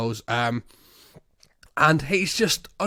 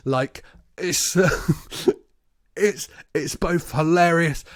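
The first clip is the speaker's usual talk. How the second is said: ordinary talk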